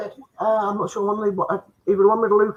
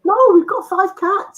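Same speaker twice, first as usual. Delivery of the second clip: silly high voice; 11 semitones higher, slower than usual. Acted. Mock quarrel in funny voice.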